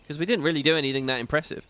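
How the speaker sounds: ordinary talk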